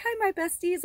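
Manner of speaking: playful imitation of a YouTuber's hello; imitating a tarot host. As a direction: pitch it way up like a channel host saying hi. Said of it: "she's like".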